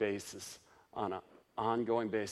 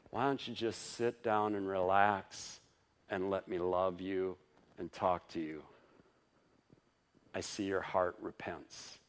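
lower, softer, gentle reassurance; tender Jesus; 3 semitones lower, 3 dB quieter than usual. Acted. Jesus calming a stressed believer, soft and low.

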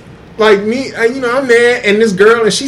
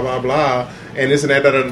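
ordinary talk

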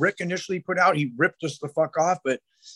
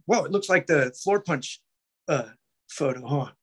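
ordinary talk

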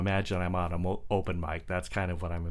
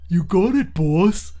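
high, loud dim-witted reply; eager goon answering the boss; loud dopey henchman; dopey thug; 9 semitones higher, 10 dB louder than usual. Acted. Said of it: "A dopey thug voice".

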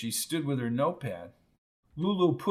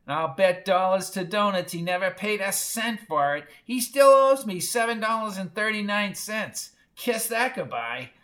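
high, scratchy grumbling; high-pitched indignant old mother; aggrieved screechy old woman; 6 semitones higher than usual. A narrator's reading. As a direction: gripe about the debt in a screech.

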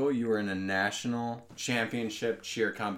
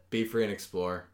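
usual speaking voice

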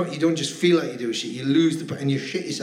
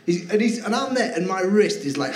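ordinary talk

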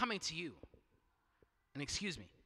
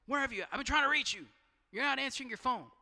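pitched up, louder, fretting over the missed calls; anxious texter; 6 semitones higher, 4 dB louder than usual. Pretend. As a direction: nag like a friend who can't reach you. Someone texting you during prayer.

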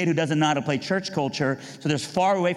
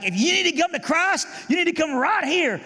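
much higher altar-call cry; mock fiery preacher. Preacher calling sinners to the altar, fiery mock altar call. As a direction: call them forward like an old-time preacher. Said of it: "I remember he was like".

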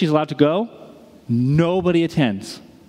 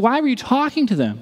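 worried teacher; 8 semitones higher than usual. Retold or imitated. Teacher questioning Ruby.

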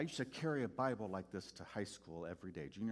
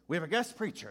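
high, loud, hearty preacher greeting; jolly pastor; 8 semitones higher, 10 dB louder, faster than usual. Retold or imitated. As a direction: boom it out like a beaming old reverend. Pastor announcing from pulpit.

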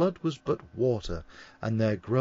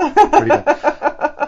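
high, loud, very slow mock voice; hammed-up silly voice; mock drawl; 14 semitones higher, 12 dB louder, much slower than usual. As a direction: stretch it out like a bad impression. Mocking the George Bush voice.

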